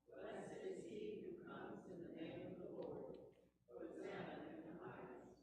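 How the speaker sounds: faint singing from far away; soft voices far across the hall; distant congregation. Real, not acted. Congregation sings Sanctus far off.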